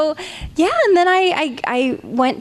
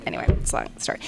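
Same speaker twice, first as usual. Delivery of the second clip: whispered aside; 7 dB quieter, much faster than usual. Real, not acted. Whispered aside, brushing past story.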